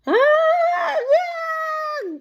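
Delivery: high loud panicked squeal; cartoon panic. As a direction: squeal in comic alarm. Elephant panics at bird.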